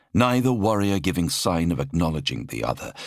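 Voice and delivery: ordinary delivery, nothing marked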